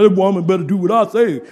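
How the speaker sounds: loud mock tough-guy voice; mock macho husband. Preacher mocking a controlling husband, loud tough-guy voice. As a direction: puff up like a bossy husband.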